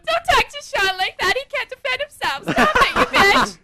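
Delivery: hammed-up shrill defence of a friend; mock protective voice.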